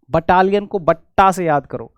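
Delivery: ordinary delivery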